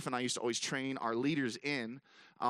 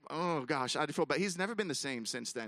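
ordinary talk

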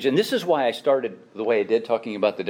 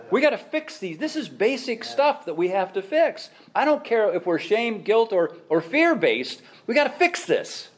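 raised, insistent plea; fired-up preaching; urgent exhortation; 9 semitones higher than usual. Real, not acted. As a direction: push the church to act. Preacher urging the congregation.

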